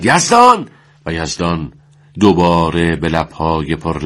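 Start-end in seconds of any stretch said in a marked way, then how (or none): 0.0-0.7 loud high call of the name; commander's shout. A narrator's reading. Commander shouting for the squad leader, loud and high. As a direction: bark the name across the field. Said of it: "سرش فریاد زد".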